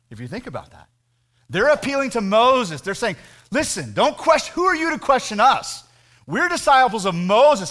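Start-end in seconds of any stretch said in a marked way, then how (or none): 3.5-7.7 raised, higher indignant rebuke; indignant scolding. Retold or imitated. Preacher voicing Pharisees rebuking the healed man, indignant. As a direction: scold him from above, pitch up.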